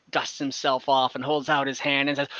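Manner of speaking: usual speaking voice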